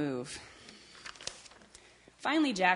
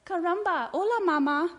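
high, loud newborn chick voice; baby chick; 11 semitones higher, 6 dB louder than usual. Acted. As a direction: greet mama in a squeaky-high baby voice. Newborn chick greets its mama.

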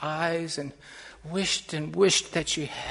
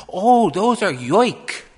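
loud, raised, rolling emphasis; impassioned explaining; 3 semitones higher, 12 dB louder than usual. A narrator's reading. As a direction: proclaim it with fervour, building each phrase. Woman explains yoik songs passionately.

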